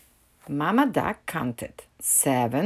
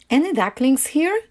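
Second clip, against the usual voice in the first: high searching question; raised, questioning call; 8 semitones higher, 4 dB louder than usual. A narrator's reading. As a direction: call to hidden ducklings, pitched up. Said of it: "she called".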